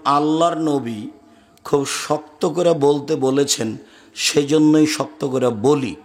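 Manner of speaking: slow, deliberate justification; measured reply. Retold or imitated. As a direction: spell it out slowly, phrase by phrase. His measured answer to complainer.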